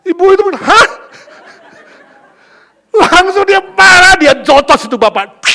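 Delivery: loud, high shout of outrage; furious outburst. Acted. Mother punches the passenger, shouting loud and high. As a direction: explode at him full force.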